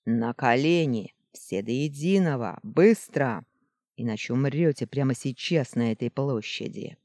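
loud barked order; barking command. Acted. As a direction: bark the order at the crowd. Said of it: "неожиданно рявкнул". (рявкающий приказ)

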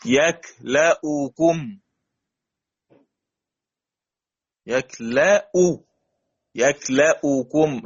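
يكلأكم slowly, the hamza with damma clear; slow tajweed demo. Shown as a demonstration. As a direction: break يكلأكم down for students. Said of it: "مثال". (يكلأكم ببطء مع إظهار الهمزة المضمومة)

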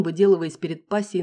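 ordinary delivery

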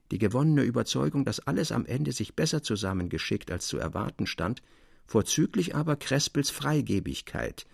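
plain reading voice

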